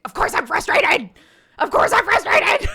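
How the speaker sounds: high, loud exasperated shout; frustrated yell. Pretend. Mom pretends to snap at her daughter, frustrated yell. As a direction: blow up at a toddler stalling bedtime. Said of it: "immediate reaction wants to be like".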